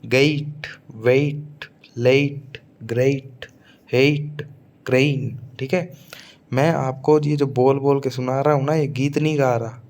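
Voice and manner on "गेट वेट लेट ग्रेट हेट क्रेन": गेट as gayt, glide from e to i; teacher models the ei diphthong; gliding ay sound. Shown as a demonstration. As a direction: stretch the ay in गेट, क्रेन. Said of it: "ए ई की मात्रा".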